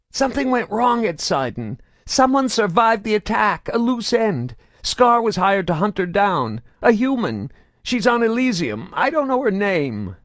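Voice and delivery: high, loud anxious voice; fearful confession. A narrator's reading. Prisoner confesses to Saren, high and anxious. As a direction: keep talking to avoid pain.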